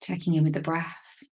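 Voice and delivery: calm, unhurried meditation voice with long pauses; even, measured delivery, leaving space to breathe; guided meditation. Real, not acted. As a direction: gently guide them into stillness, phrase by phrase.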